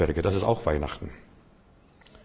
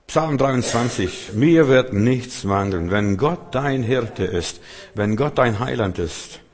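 solemn recitation; slower than usual. Acted. Slow solemn scripture quote.

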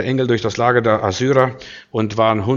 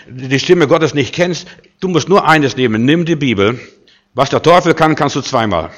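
normal talking voice

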